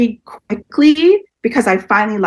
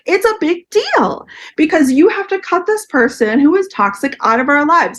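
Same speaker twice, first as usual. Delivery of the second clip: imitating her insistence; 8 semitones higher than usual. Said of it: "I'm like".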